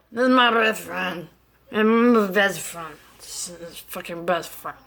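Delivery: put-on slurred, mumbled drunk talk; mushy drunken mumble, trailing off; drunk girl impression. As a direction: imitate someone barely conscious and slurring.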